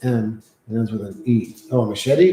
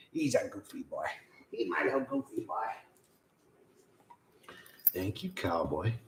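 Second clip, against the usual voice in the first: whispered, softer thanks to the dog; 6 semitones lower, 16 dB quieter than usual. Real, not acted. Thanking his dog for sitting with him, whispered. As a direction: breathe it gently to the dog.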